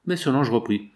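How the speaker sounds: usual speaking voice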